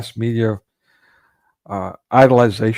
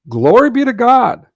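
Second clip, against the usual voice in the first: higher and louder, a ringing thankful cry; exclaiming praise; 10 semitones higher, 5 dB louder than usual. Retold or imitated. Explaining Greek custom to viewers, a ringing glad exclamation. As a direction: proclaim it with joy to the heavens.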